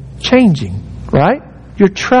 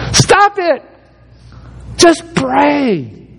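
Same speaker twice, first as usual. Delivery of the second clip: exclaiming; 8 semitones higher, much slower than usual. Real, not acted. Preacher breaking off his own mockery, exclaiming. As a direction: cut the nonsense, sharp and high.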